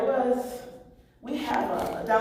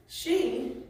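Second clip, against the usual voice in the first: normal talking voice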